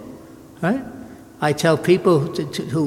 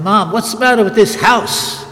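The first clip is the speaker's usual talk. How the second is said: put-on higher, louder cheeky kid complaining; bratty child; 4 semitones higher, 4 dB louder than usual. Priest recalling himself as a boy sassing his mother, bratty. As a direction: play the little brat challenging mom. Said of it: "You're a little brat I was".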